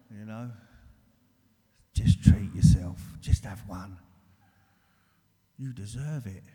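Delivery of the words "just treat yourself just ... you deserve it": loud, slow, coaxing put-on voice; the addiction pushing him to drink; tempting illness voice.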